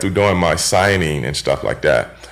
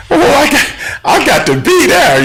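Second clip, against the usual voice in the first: mock eager excitement; 3 dB louder than usual. Eager quote of himself wanting in.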